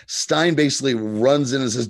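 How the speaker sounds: usual speaking voice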